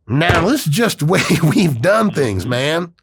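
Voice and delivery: slow mocking traditionalist; mock stubborn old-timer.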